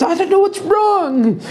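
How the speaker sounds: very high whining voice; very high helpless archer. Acted. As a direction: squeak it out, lost. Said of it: "She says".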